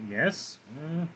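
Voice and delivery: usual speaking voice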